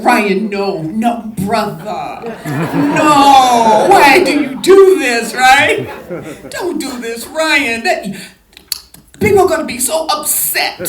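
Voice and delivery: loud, laughing mock protest, pitch up; half-joking plea to stop killing her off; pleading protest. Actress loudly protesting to her director about her character's death. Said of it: "I was like".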